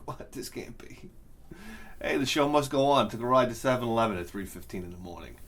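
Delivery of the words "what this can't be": whispered disbelief, much softer; stunned whisper. Retold or imitated. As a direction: breathe it out in shock. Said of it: "I was like".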